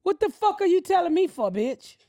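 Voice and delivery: high, irritated yell. Real, not acted. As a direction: snap at the intruder. Yelling at intruder.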